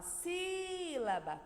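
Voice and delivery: sílaba with a long, high SÍ; calling the word. Shown as a demonstration. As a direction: call sílaba as if it were far away. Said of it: "como se eu estivesse chamando a sílaba".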